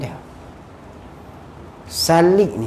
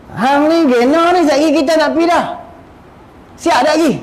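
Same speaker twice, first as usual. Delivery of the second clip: higher-pitched, exasperated nagging; impatient scolding; 7 semitones higher than usual. Acted. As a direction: hurry him up, fed up. Scolding a dawdling companion. (menegur dengan tidak sabar, nada tinggi)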